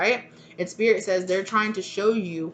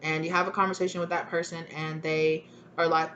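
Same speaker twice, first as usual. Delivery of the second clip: normal talking voice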